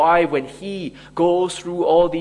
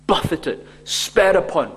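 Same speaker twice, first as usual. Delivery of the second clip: ordinary talk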